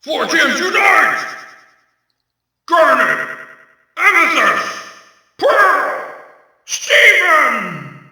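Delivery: loud, high battle shouts; shouted attack calls. Acted. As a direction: yell each name to rally.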